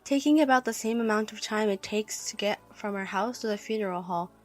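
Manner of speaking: ordinary delivery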